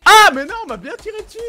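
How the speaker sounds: high, loud, insistent protest; indignant insisting. Real, not acted. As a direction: insist hard that you are right. Insisting he was shot.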